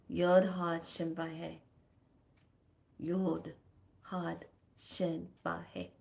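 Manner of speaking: slow, fading spoken chant; slow solemn recitation. Shown as a demonstration. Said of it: "May you repeat that statement".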